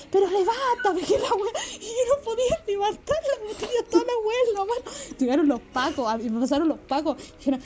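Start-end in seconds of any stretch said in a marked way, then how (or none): 0.1-1.6 mock yell. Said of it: "me empezó a gritar así como". 1.8-5.2 high, giggling narration; laughing. Real, not acted. Telling the camera about the bottle, laughing. Laughing at her own memory.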